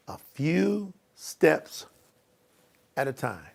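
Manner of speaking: very slow and quiet, word by word. Shown as a demonstration. Coach reads the boxed words to overwhelmed listeners, slow. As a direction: draw out each word softly, pausing between. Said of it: "say it slowly".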